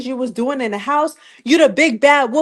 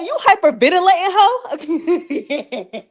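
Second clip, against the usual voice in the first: laughing through it, higher; laughing tease; 4 semitones higher, slower than usual. Real, not acted. Vlogger laughing at the cast member's panic. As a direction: giggle the question out.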